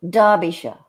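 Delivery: Derbyshire as DAH-bee-shuh; British pronunciation. Shown as a demonstration. Host trying the new rule, Derbyshire as DAH-bee-shuh. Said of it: "all the shires are pronounced sure".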